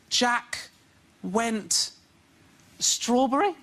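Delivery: put-on child reading aloud. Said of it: "when my son goes".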